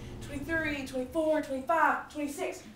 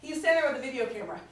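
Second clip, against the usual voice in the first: usual speaking voice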